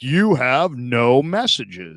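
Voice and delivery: slow, measured machine announcement; automated voicemail voice. Retold or imitated. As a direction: imitate a voicemail robot, word by word. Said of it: "it always would be like".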